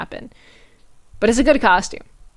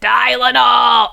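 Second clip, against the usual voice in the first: mock RFK cry; 3 semitones higher, 5 dB louder, much slower than usual. RFK Tylenol joke callout.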